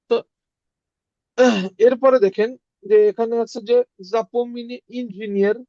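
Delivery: ordinary talk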